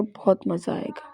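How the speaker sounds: breathy conspiratorial whisper. Acted. Girl whispering her plan to her friend, unvoiced whisper. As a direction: let it slip out as a secret.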